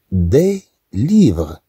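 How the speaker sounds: "دي ليفغ" said slowly with de; slow model pronunciation. Shown as a demonstration. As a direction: draw out "دي ليفغ" for the class. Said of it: "عندها هاد أو إس".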